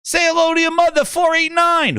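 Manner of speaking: high, shouted mock introduction; bragging gamertag fan. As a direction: holler the tag like a proud announcement. Fan bellows his gamertag at host.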